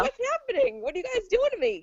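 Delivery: very high, giddy questioning; excited bewilderment. Retold or imitated. Healer asks her spirits about the heat, giddy and bewildered. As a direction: squeal it in excited disbelief. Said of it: "I was obviously excited".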